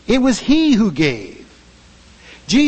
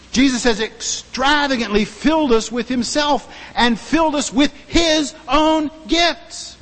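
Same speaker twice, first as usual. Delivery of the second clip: normal talking voice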